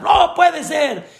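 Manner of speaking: high, mock indignant protest; father yelling in protest. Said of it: "y empezó a gritar".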